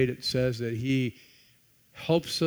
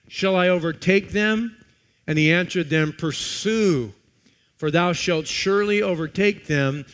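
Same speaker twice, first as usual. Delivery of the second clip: louder, declaiming King James verses; preached scripture reading; 3 semitones higher, 7 dB louder than usual. Acted. Pastor reading the David passage to the church, declaimed. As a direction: proclaim the verses to the congregation. Reads scripture aloud with force.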